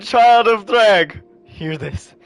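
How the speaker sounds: slow, loud, high proclamation; drawn-out, forceful introduction; dramatic announcement. A narrator's reading. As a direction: announce the character with big hype. Announcing the next character dramatically.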